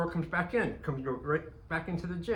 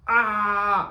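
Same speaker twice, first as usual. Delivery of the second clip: sudden high loud yell of a man fleeing; imitated running scream; 8 semitones higher, 10 dB louder than usual. Henry screaming as he runs from the squirrel, loud panicked yell. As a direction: cry out like someone running for his life. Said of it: "like a moving scream".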